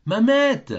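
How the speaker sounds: high, loud call to the back room. A narrator's reading. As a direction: call her from across the house. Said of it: "en appelant". (appel fort vers le fond)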